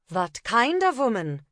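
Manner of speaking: put-on a German accent, asking slowly. Una, disguised, answers the copper in a German accent. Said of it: "feigning a German accent".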